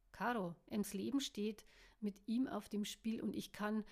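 plain reading voice

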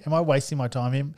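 normal talking voice

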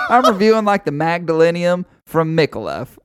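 mocking cigar reviewer. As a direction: ham up the clueless reviewer. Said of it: "He's like".

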